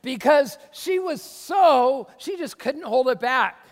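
loud, slow, bursting with enthusiasm; excited shout. Real, not acted. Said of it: "I was getting so excited".

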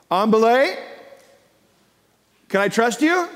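"On belay" and "trust you" high, both rising; questioning call. Retold or imitated. Climber asks again once ready, questioning call. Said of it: "say again".

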